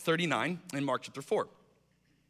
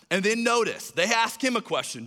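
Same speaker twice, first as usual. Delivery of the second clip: normal talking voice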